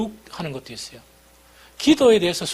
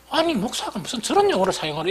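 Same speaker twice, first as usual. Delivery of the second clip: higher-pitched, lightly indignant retort; mock indignation; 7 semitones higher than usual. Listener criticising a pastor's choice of words, mock indignant. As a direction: imitate a critic taken aback. Acts his own shocked criticism.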